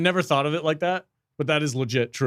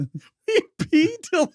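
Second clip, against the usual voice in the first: high, slow laughing mockery; mock it through laughter; much slower than usual. Mocking the phrase.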